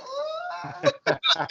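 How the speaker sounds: high cackling laugh after the bit. Real, not acted. Laughing at his own leering impression, high cackle. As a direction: burst out laughing.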